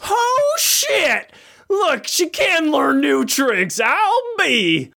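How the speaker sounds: high, amazed exclamation; surprised. Real, not acted. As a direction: gasp out in happy shock. Surprised reaction to her order.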